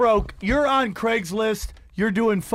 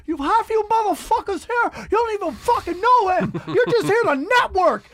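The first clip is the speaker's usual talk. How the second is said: very high, loud imitation of a furious rant; flaming everybody; 12 semitones higher, 5 dB louder than usual. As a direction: lay into the whole room, mock-enraged. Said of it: "He starts just flaming everybody".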